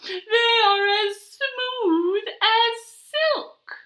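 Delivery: high, slow, lilting boast; sing-song vain hen. Acted. Zinnia boasting her eggs are silky, high slow sing-song. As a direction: croon it like a proud hen.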